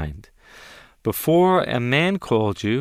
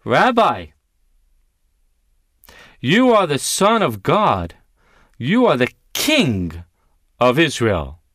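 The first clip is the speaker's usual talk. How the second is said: voicing Nathanael; 4 dB louder than usual. Nathanael answering Jesus, loud and awed. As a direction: cry it out in wonder. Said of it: "I want you to think these words".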